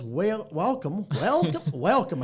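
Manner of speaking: drawled southern welcome, loud and high; mock southern host greeting. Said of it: "I was trying to talk like him".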